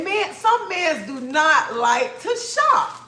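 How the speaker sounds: high, slow, word-by-word verdict; drawn-out, exasperated pronouncement; exasperated emphasis. Retold or imitated. Emphatic exasperated verdict on men shopping.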